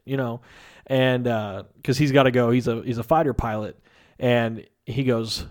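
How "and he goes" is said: ordinary talk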